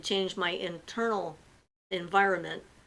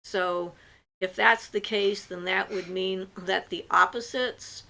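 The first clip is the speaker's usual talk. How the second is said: ordinary talk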